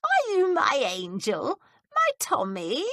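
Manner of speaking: put-on frail old woman crooning to a visitor she mistakes for Tommy; sing-song. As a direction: croon it like a confused old lady. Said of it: "she said in a sing-song voice".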